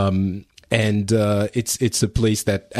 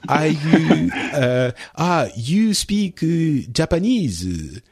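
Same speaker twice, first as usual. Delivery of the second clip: put-on slow, higher, teasing Japanese accent; mock Japanese accent; 6 semitones higher, much slower than usual. Co-host teases the guest in a mock Japanese accent. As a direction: drag out Japanese in a joking accent.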